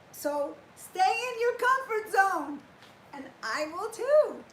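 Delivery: loud, high, slow ironic challenge; mock advice. Real, not acted. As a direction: tease them, drawn out.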